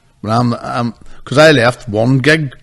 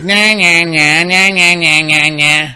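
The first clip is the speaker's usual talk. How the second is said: higher, louder string of identical nahs; nagging nonsense noise; 6 semitones higher, 4 dB louder, slower than usual. Acted. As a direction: mimic an accent heard as pure noise. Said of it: "all they hear is".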